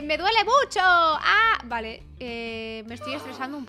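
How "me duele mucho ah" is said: slow, rising play-acted wail; mock cry of pain. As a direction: build into a loud pretend pained cry. Voices woman in labour.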